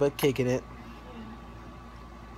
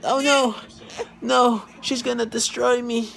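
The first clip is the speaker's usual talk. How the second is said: high, playful alarm; hammed-up cry of dread; mock fear; 7 semitones higher than usual. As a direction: act doomed before a tiny tyrant. Pretends fear of angry baby.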